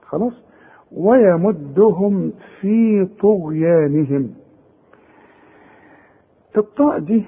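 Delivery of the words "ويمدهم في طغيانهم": ويمدهم في طغيانهم recited slowly; careful tajweed reading; slow recitation. Shown as a demonstration. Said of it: "ما تغنهاش".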